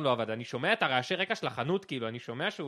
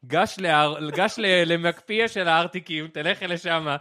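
loud commands; 8 dB louder, much slower than usual. Retold or imitated. Directing Ran over phone, loud.

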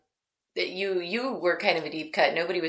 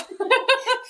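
high laugh. Real, not acted. Laughs at own voice.

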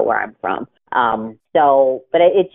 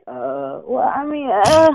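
mock reluctant co-host; 8 semitones higher, slower than usual. Co-hosts squirming at the question.

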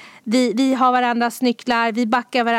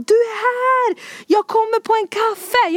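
high-pitched mock calling-out; imitated neighbour's yell; 10 semitones higher than usual. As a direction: act out a neighbour calling down from the balcony. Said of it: "en granne ute på balkongen skrika". (härmar grannens glada balkongrop)

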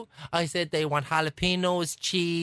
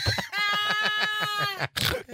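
real laughter. Real, not acted. After asking to keep the top, cracking up. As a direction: crack up high at your own story.

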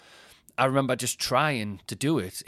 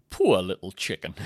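lightly voiced pitying simpleton, a bit louder; Lenny from Mice and Men; 3 semitones lower, 5 dB louder than usual. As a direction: mourn the chicken, with only a hint of character. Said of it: "You are like Lenny from Mice and Men".

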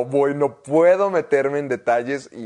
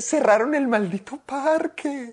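comic panic; 12 semitones higher than usual. Real, not acted. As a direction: squeal in fake despair. Mock panic, very high.

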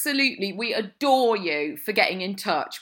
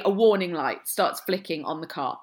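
ordinary talk

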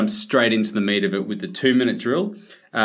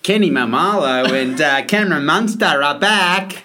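put-on Queensland accent; 6 semitones higher, 6 dB louder than usual. Accent play on North Queensland.

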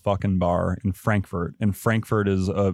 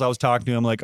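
normal talking voice

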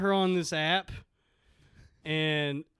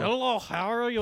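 higher, put-on Russian accent; hammed-up Russian greeting; 5 semitones higher than usual. Shown as a demonstration. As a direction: try out a thick Russian accent. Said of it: "I'm trying to see if I can do a Russian voice".

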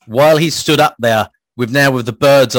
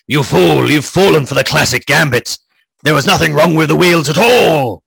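louder, gloating mock-villain shout; triumphant shout back; 3 semitones higher, 4 dB louder than usual. Ichabod taunts the tricked scarecrow from the carriage, triumphant shout. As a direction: crow it out like a hammy schemer. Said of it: "turns and shouts back".

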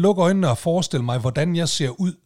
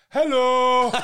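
high, drawn-out mimicked "hallo" call; imitated shouting; 7 semitones higher, 4 dB louder than usual. Impatient stranger calling to a man ignoring him, imitated shout. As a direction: mimic someone calling out for attention. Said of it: "Han begynder at stå og råbe".

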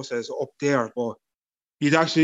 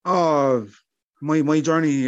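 ordinary talk, nothing marked

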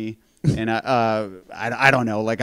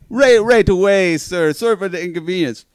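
mock polite hotel clerk; higher, fake-polite service voice, sir at the end; 6 semitones higher, 7 dB louder than usual. Imitating a polite resort clerk to his audience.

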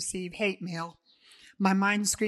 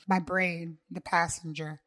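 ordinary delivery

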